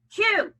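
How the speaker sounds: Q said loud and high; accented letter. Shown as a demonstration. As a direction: punch Q. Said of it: "accent every other letter".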